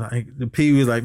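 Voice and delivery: ordinary delivery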